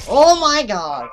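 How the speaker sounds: loud, drawn-out high yell; exasperated shout. Real, not acted. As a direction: stretch it out in exasperation. Failing the game.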